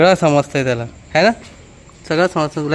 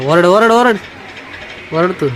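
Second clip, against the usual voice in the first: urging to shout; 4 semitones higher than usual. Real, not acted. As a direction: push him: shout, shout, shout.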